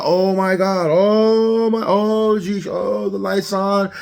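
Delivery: freaking out. Acted. Driver sees check engine light come on, freaking out. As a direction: wail it in an exaggerated panic. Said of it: "you start freaking out".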